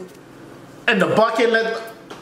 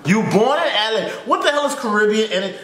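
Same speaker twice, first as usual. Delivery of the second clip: ordinary talk, nothing marked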